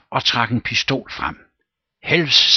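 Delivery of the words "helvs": a loud, high, urgent cry of the name; alarmed call. Acted. Rom calling his friend in sudden alarm. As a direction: snap his attention with alarm. Frightened Rom calls the captain.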